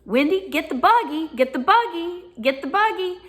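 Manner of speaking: a grandmother hurrying her grandchild along; grandmother calling. Retold or imitated. Grandmother tells young Wendy to fetch the cart, calling out. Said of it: "She said".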